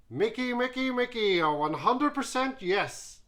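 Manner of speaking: very high, loud, beaming praise; happy, almost shouting. Acted. Judge cheering the Derry singer, almost shouting. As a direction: nearly shout it with joy. Louis votes enthusiastically.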